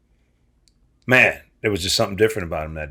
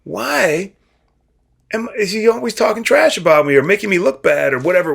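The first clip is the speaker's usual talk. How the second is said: frustrated high whine; 12 semitones higher, 6 dB louder than usual. Retold or imitated. Retold frustrated question to himself.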